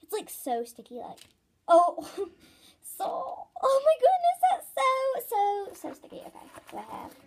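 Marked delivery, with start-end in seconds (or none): 1.7-5.7 high, loud laughing squeal; high giggling excitement; giggly squeal. Real, not acted. Toy sticks to hands.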